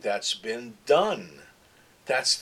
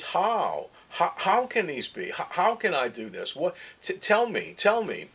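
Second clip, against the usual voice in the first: high-pitched, pleading questions; appealing Nicodemus; 6 semitones higher than usual. Acted. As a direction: plead for an answer, stumbling over repeated words. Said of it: "Nicodemus is coming and appealing to him".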